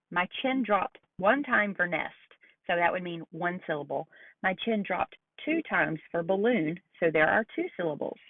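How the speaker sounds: ordinary talk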